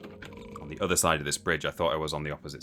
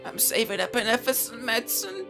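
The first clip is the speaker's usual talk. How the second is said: much higher, whiny beggar voice; pleading beggar. Retold or imitated. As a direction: whine it like a street beggar. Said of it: "redubbed all the rumours in a beggar's voice".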